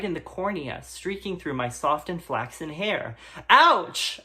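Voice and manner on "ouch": sharp high yelp, loud; ouch. Acted. Hit by a paper airplane, a loud high yelp. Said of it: "I said".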